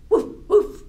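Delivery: loud, high dog barks; two short, punchy barks; dog woof. Shown as a demonstration. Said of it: "Can you woof like a dog?".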